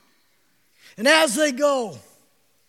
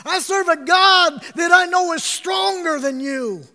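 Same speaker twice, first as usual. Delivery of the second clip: rising to a bold shout; defiant declaration; 2 semitones higher than usual. Retold or imitated. Preacher voicing the youths defying the king, bold shout. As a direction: proclaim it boldly, faith swelling.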